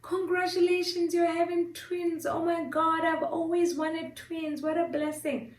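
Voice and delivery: high gushing well-wisher voice; mock excited congratulations. Excited people congratulating her on twins, mock gushing. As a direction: gush in a high imitation of excited friends. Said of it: "is, like, so excited".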